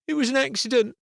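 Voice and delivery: put-on a boy defending himself; child snapping back in an argument; defensive boy. A narrator's reading. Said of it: "a far more defensive attitude".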